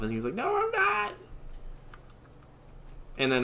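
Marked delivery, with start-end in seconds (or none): 0.3-1.1 hammed-up whiny protest; mock flustered denial. Boy teased about being in love, mock flustered denial.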